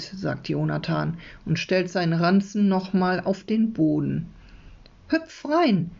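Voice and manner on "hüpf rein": put-on boy's voice. A narrator's reading. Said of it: "versprochen ist versprochen".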